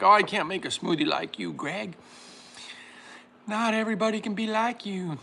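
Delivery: a hint of a doting mom; mother's voice. Son quoting his mom, lightly high-pitched. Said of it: "She's like".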